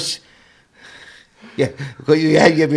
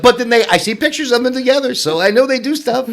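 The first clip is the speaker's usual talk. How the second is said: much higher pitch, bubbling with laughter; laughing joke; 11 semitones higher than usual. Real, not acted. Host joking about a couple never together, laughing. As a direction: laugh your way through the teasing remark.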